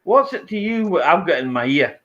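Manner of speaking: acted-out indignant comeback; indignant retort. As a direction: throw the challenge back at a nosy stranger. Acted retort to confrontational stranger.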